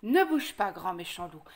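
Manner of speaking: ordinary delivery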